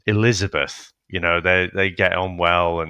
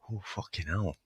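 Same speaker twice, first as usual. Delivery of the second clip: muttered disbelief; 12 dB quieter than usual. Retold or imitated. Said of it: "I was like".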